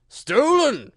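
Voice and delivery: high, loud cry of accusation; exclaimed accusation. A narrator's reading. King accuses the Hatter before the jury, loud exclaiming. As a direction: exclaim it sharply as an outraged king. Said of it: "the king exclaimed".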